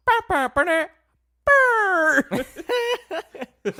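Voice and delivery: high loud mock bleeping; censor bleep noise. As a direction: bleep the word out in a squeaky burst. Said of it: "it's like".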